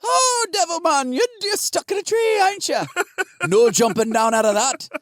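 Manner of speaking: high, hammed-up Irish storyteller mocking the devil; high mock Irish brogue, teasing; Irish accent. Teller mocks the devil stuck in a tree, high mock Irish accent. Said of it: "cannot tell the difference between Scottish and Irish".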